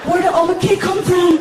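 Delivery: put-on high, loud child voice; little kid. Son waking at night to cake, high child voice. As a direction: say it like an excited kid. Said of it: "and he's all".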